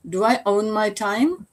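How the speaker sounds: usual speaking voice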